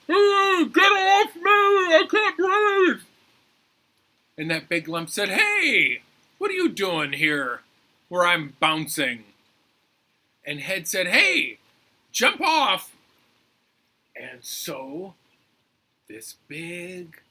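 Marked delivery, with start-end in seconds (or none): 0.1-3.0 panicked shout. Acted. Head squashed by big lump, panicked shout. 5.3-9.2 loud opening yell, dropping into complaint; indignant shout. Acted. Big lump challenging Head, indignant shout. 11.1-12.9 high, loud command; shouted order. Acted. Head ordering the lump off, shouted.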